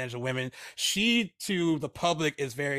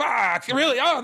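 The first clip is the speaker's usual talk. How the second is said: loud, high disbelieving cry; shocked outcry; 10 semitones higher, 9 dB louder, slower than usual. Real, not acted. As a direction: shout it in shock. Hearing the answer, dismayed.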